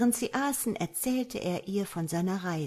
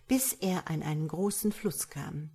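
plain reading voice

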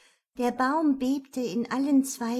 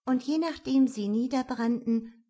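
ordinary delivery

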